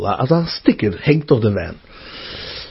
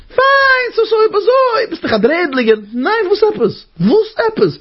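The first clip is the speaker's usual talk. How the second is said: very high, agitated quote; exclaiming protest; much faster than usual. Acted. Arguing back with the tempter over one look, indignant. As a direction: shoot up high into mock indignation.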